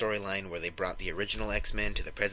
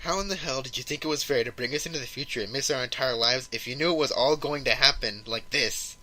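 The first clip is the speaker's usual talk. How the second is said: higher, louder retold rant; angry all-caps outburst; 4 semitones higher, 3 dB louder than usual. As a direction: act out a dead-serious, indignant demand. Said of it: "in all caps, mind you, so you know ... he's being serious".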